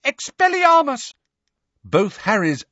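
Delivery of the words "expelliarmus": very high, loud frantic incantation; cried-out spell. A narrator's reading. Neville aiming wildly at the struggling pair, frantic cry. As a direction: yell the spell at the top of your range. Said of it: "he cried".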